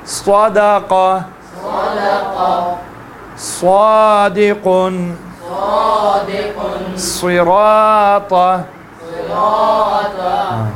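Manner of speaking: صادق and صراط drawn out very slowly, thick ص; heavy drawn sounds. Shown as a demonstration. Teacher chanting heavy ص words slowly. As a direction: stretch each word long, heavy ص. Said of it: "موٹا پڑھیں گے".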